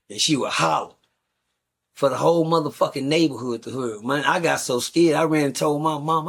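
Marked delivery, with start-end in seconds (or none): none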